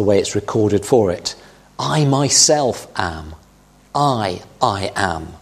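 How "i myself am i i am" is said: slow, weighty stress on "I myself am" and "I, I am"; emphatic I am. Shown as a demonstration. Preacher stressing Jesus' words to the congregation, slow and emphatic. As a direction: lean hard on "I" and "am", slow and deliberate. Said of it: "it becomes emphatic".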